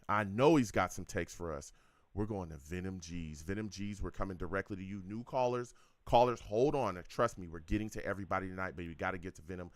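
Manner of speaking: ordinary talk, nothing marked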